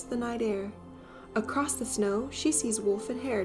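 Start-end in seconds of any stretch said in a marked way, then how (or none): none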